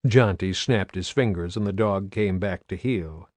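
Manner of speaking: ordinary delivery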